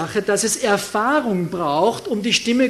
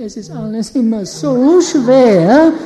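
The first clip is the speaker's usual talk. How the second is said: slow, louder whining imitation; whiny voice; 10 dB louder than usual. Preacher mimics complainers to congregation, slow whiny voice. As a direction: imitate someone who always complains. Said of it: "die haben so eine weinerliche Stimme".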